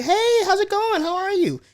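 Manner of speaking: high, sing-out casual hello; fake-friendly greeting. Random people asking how you are, high and fake-friendly. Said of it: "random people ask you".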